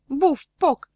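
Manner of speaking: loud, high bang-bang of a crash; crash sound effect. A narrator's reading. Narrator sounding the kiosk smashing, loud. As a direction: burst out the crash noise.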